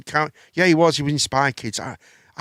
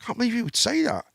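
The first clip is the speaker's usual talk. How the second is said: quiet stunned disbelief; 7 semitones higher, 7 dB quieter than usual. Retold or imitated. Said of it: "I thought".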